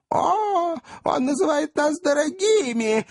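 high, shrill-voiced outraged old governess crying out; shrieking old governess. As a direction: squeal it like a scandalised old spinster. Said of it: "воскликнула самая старая и безобразная воспитательница". (визгливый возглас старой воспитательницы)

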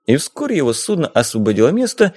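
ordinary delivery, nothing marked

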